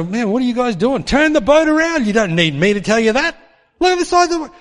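high, loud mock scolding. Jesus mock-scolding the disciples, loud and high. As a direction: bark orders at the crew, hammed up.

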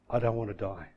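low, soft imitated plea; weak plea. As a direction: plead faintly to your mum. Comatose son pleads to his mum.

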